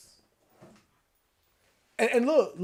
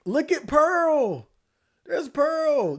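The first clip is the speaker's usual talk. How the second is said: excited spotting; 10 semitones higher, 4 dB louder, much slower than usual. Real, not acted.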